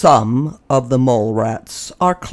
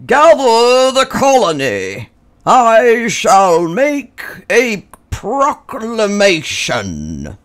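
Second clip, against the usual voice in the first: higher, louder, very slow and grand; heroic regal voice; 9 semitones higher, 5 dB louder, slower than usual. A narrator's reading. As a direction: proclaim like a king. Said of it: "he said in a heroic, regal voice".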